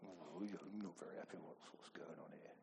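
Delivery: a grumbler complaining, quiet and low; grumbling. Retold or imitated. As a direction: mutter discontent under your breath.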